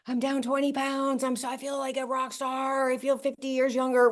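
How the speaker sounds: high, excited retelling of a friend's boast, a bit hammed-up; fired up. Friend calls to brag about his results, voiced high and fired up. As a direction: pitch it up like a buddy bragging he feels young. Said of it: "he was fired up".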